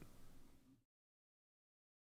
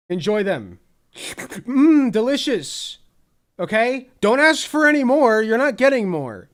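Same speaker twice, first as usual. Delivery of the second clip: taunting; 7 semitones higher, 8 dB louder than usual. Acted. Taunting chat about verdict.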